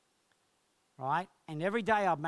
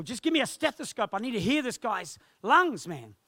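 raised, high-pitched urgent demand; imitated yell, pitched up; 5 semitones higher, 5 dB louder than usual. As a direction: yell at people for help, retold. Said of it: "Glenn's yelling at people".

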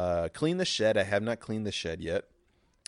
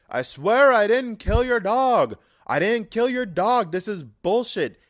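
Tommy Wiseau; 13 semitones higher, 11 dB louder than usual. Acted. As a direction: plead your innocence at full volume. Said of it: "my Tommy Wiseau impersonation".

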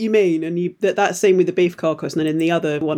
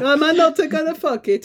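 mock dubbed line; 9 semitones higher than usual. Echoing the line higher.

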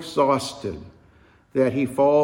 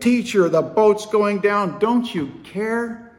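high, louder desperate plea to the sleeping teacher; panicked cry; 8 semitones higher, 3 dB louder than usual. Acted. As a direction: cry out over the storm as the boat sinks. Disciples panic in sinking boat.

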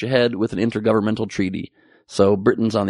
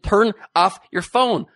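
higher, slow, stern stewardess demand; mild mock stern order; 9 semitones higher, slower than usual. Comic imitates a rude stewardess ordering him, mock stern. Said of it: "she was crazy rude and yelled at me".

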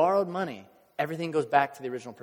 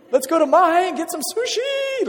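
high, loud celebratory shriek; acted whoop of joy at great news; so excited; 9 dB louder than usual. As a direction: squeal it out at full volume. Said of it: "you'd be so excited".

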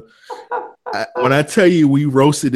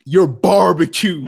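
high, loud, drawn-out imitation taunt; imitating a roaster's slow, loud put-down; mock roast punchline; 7 semitones higher, 4 dB louder, slower than usual. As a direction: stretch out the mock insult.

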